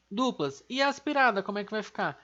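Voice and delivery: usual speaking voice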